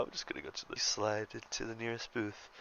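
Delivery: ordinary delivery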